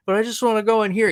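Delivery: mock-innocent objection; innocent pleading. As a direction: imitate a guest making a naive plea. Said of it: "it was just so innocent".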